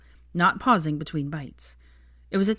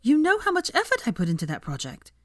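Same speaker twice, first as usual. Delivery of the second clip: indignant; 11 semitones higher, 3 dB louder than usual. Acted. Hermione indignant at refusal.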